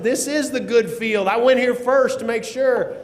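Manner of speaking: high, slow, emphatic protest; exasperated outburst. Retold or imitated. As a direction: act out a frantic caller arguing back. Frantic caller insists in retold call.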